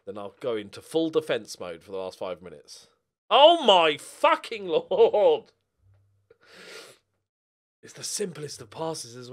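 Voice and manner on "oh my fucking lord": slow, loud anguished yell; drawn-out outraged shout at the screen; furious outburst. Real, not acted. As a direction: roar it out in disbelief. Goal conceded from simple pass.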